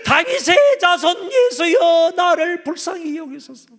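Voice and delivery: high, loud, slow desperate cry. Retold or imitated. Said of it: "더 큰 소리로 부르짖어".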